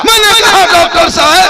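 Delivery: sudden high, loud call; full-force preaching. Retold or imitated. Preacher retelling his challenge to a doctor, shouted preaching. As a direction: cry out to the doctor as if across a crowd.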